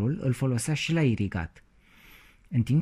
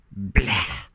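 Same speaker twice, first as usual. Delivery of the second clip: disgusted bleh; 2 semitones lower, 4 dB louder than usual. A narrator's reading. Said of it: "cu tot dezgustul".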